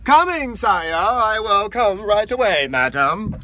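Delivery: loud, slow, ceremonious servant calling out; grandiose royal servant. Pretend. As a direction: answer the queen with hammed-up pomp, loudly. Plays royal servant answering queen.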